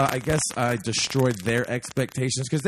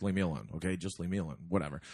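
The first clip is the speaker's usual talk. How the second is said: low, very soft dismissive mumble; retold weary brush-off; 10 semitones lower, 13 dB quieter, faster than usual. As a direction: imitate waving someone off under your breath. Retold brush-off of people begging.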